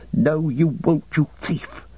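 high, panted accusation; breathless fear and enmity, dying to a hiss; panting. Acted. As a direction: pant it out, fearful and hostile. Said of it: "he panted".

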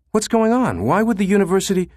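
high, fast rising panic; alarmed. Acted. Susan pressing David on the phone, high and alarmed.

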